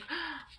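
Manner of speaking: light mock inhale of wonder; soft high theatrical gasp; mock gasp of relief. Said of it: "it wasn't like".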